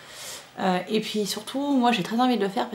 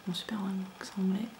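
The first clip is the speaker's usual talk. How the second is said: a dry, whispered aside, much softer; wry whisper; 11 dB quieter, faster than usual. Real, not acted. Vlogger mocking her own English pronunciation, whispered. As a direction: tease yourself under your breath.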